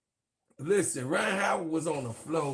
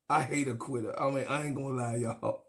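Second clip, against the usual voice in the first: quiet aside; 6 semitones lower, 8 dB quieter than usual. Real, not acted.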